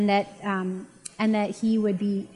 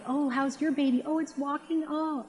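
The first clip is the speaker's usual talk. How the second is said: higher, softer cooing mom chatter; 5 semitones higher, 3 dB quieter than usual. Retold or imitated. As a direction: lightly imitate mothers gushing over a baby. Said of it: "the moms are probably like".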